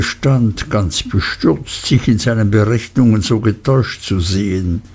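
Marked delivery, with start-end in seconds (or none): none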